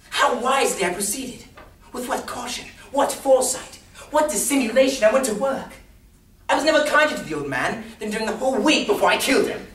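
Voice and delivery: high, loud gloating declamation; exultant madman at full voice; manic boasting. Acted. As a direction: crow over your own cleverness. Madman boasts of his cunning.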